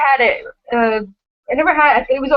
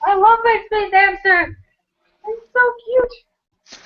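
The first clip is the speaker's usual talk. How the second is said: cooing; 10 semitones higher, slower than usual. Real, not acted. Cooing over space hamster.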